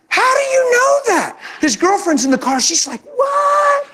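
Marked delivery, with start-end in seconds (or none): 0.1-1.4 amazed question. Retold or imitated. Driver amazed in traffic. 3.1-3.9 shocked what. Retold or imitated. Girlfriend shocked in car.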